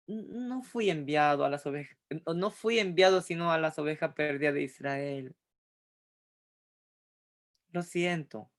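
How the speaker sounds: soft, hesitant, apologetic refusal; Jesus gently declining with regret; gentle apology. Shown as a demonstration. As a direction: let her down softly, sorry to say no. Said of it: "¿Con qué tono de voz ustedes creen que Jesús habría dicho?".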